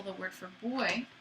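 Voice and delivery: usual speaking voice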